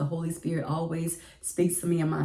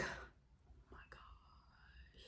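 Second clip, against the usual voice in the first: breathless whisper of shock; whispered oh my gosh; 29 dB quieter, much slower than usual. Retold or imitated. To herself on hearing the principal called, whispering. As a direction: whisper it, stunned.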